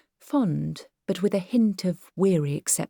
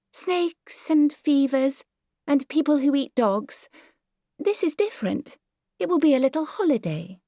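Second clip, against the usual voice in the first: much higher, airy dismissive exclamation; vague Myrtle voice; 10 semitones higher than usual. Acted. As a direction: brush the question off like a flighty lady. Myrtle waves off danger.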